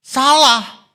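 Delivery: high, loud cry of Salah; forceful shout. Real, not acted. Preacher rejecting fear-based teaching with a shout. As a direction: shout it down as wrong.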